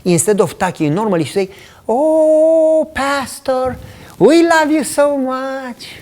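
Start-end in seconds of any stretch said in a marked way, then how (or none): 1.9-6.0 mock high, gushing church-lady voice; sweet falsetto gush at the pastor; high-pitched gushing. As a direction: imitate her sugary high pitch, dragged slow. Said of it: "she would have a higher pitch".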